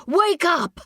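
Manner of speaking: high, urgent wake-up cry; short high-pitched shout; scream. A narrator's reading. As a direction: scream to rouse a sleeper. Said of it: "he screams".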